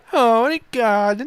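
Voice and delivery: high mock German accent. Shown as a demonstration. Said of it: "That's German".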